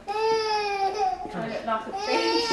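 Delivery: high, laughing through tears; half-laugh, half-sob at herself; crying laugh. Real, not acted. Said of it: "Sorry, I keep crying".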